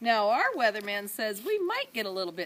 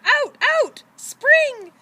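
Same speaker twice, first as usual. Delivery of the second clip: put-on much higher, loud baby shout; 15 dB louder, much slower than usual. A narrator's reading. Baby shouting to her brother and sister, gleeful. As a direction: yell it gleefully from the crib. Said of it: "shouted to her brother".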